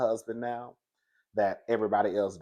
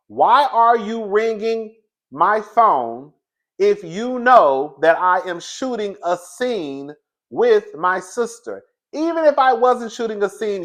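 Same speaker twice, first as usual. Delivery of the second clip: loud, high-pitched indignant telling-off; exasperated mock rant; 9 semitones higher, 9 dB louder than usual. Acting out Britt's annoyed reply.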